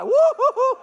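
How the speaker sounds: loud high whoop of delight; excited whoop. Real, not acted. Preacher whooping to the church about bacon, excited. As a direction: let out a big celebratory woo.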